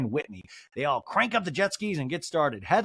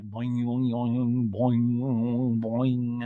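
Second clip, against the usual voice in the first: lower, sung boing like a dramatic score; imitated music; 4 semitones lower, much slower than usual. Recapper imitating the show's dramatic music with low boings. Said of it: "there's music. It's, like, dramatic".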